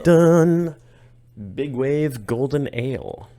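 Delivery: slow, loud game-show reveal; dramatic announcer. Acted.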